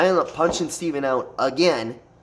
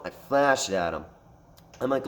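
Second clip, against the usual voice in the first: ordinary talk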